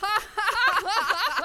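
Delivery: high happy laughter; joyful laugh. Acted. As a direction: burst into laughter. Laughter wouldn't stop.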